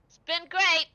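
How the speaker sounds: high, loud, cheery farewell; bright chirpy send-off; cheerful merchant goodbye. Acted. Merchant NPC says goodbye.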